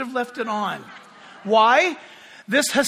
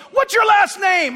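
loud demand; 8 semitones higher, 5 dB louder than usual. Retold or imitated. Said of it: "He goes".

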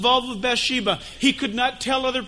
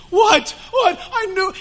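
caught off guard; 9 semitones higher, 5 dB louder, slower than usual. Acted. Shocked sinner caught.

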